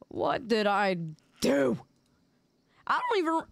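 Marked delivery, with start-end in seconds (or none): 2.9-3.4 real voice crack mid-phrase. Real, not acted. Streamer's voice cracks while grumbling at chat. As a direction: voice cracks up high. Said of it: "did my voice just crack?".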